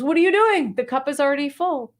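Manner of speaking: indignant shocked outburst; startled outcry. Retold or imitated. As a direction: yelp it as hot tea splashes your lap. Young monk startled by spilled tea.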